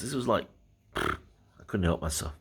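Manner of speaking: ordinary delivery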